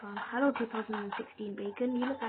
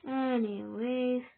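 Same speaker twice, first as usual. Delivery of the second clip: drawn-out anyways; much slower than usual. Real, not acted. Drawn-out topic change.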